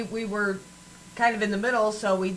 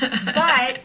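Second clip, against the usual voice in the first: slow, louder Southern accent; Southern drawl; 6 semitones higher, 9 dB louder than usual. Shown as a demonstration. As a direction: lean into a Southern drawl on "y'all". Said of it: "I can also get down, down south".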